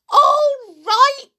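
very high, loud incredulous screech; outraged yell. A narrator's reading. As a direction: throw it back as a furious shriek. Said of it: "she yelled".

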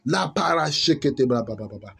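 ordinary talk

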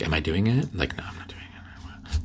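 whispery failed vocal fry; vocal fry attempt. Shown as a demonstration. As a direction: try the fry, fail. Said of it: "I try to do it".

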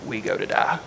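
low, hushed and grave, dropping to a whisper; grim, resolved Thomas. Retold or imitated. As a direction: say it like a man ready to die. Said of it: "Thomas kinda looks at the group and says".

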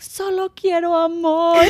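put-on high, slow, whiny kid voice; whiny crying child. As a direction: mimic a sobbing toddler. Child crying, mother imitates.

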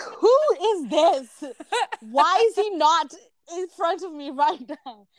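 high, slow, breathy awe; starstruck gasp. Retold or imitated. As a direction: swoon over him. Fangirl reaction to idol video.

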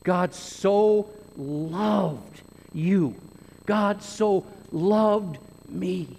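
"so" stretched slow and heavy; emphatic so. Shown as a demonstration. Preacher driving the verse home to his listeners, slow and emphatic. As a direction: linger on "so", let each word land. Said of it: "God's love is emphatic".

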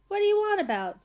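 sharp, high, loud protest; indignant outburst. Acted. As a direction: blurt it out in alarm. Arthur bursts out in protest.

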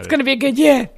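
loud, emphatic enthusiasm; excited. Real, not acted. Film fan looking over upcoming releases, excited. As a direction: burst out with anticipation. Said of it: "[name]'s excited".

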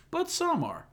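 spring the punchline up high; comedian's punchline. Retold or imitated. Retelling Tom Segura's bit, punchline squeaked up high. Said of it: "So basically he says".

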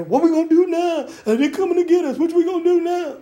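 high, anxious worried questions; panicky people. Retold or imitated. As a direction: voice the frightened crowd fretting. Frightened people asking Moses.

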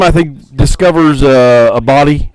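normal talking voice

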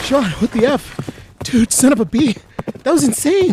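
high, loud frantic outburst; panicked yelling. Acted. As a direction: shout it out after escaping. Horses escape, panicked and loud.

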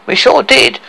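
high, boastful admission; proud. Acted. Dwarf owning up to the poisoning, proud. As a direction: crow it with pride. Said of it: "said Tricky proudly".

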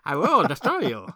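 high, hammed-up German supervillain voice, laughing through it; German villain accent. Host voices the Red Skull threatening Steve Rogers in a German accent. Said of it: "But with a German accent".

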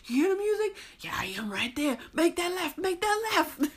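high, loud excited directions; calling out directions, thrilled; excited phone call. Retold or imitated.

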